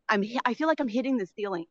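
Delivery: normal talking voice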